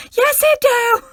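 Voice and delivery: very high, loud put-on squeal; imitating the Vine reply, high and loud; Vine quote voice. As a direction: shriek it back like the Vine. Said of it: "quote Vines".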